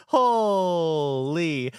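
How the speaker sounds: ordinary talk, nothing marked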